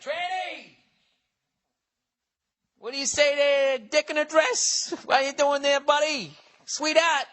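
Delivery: hammed-up leering heckler; mock catcaller. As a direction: taunt like a street heckler.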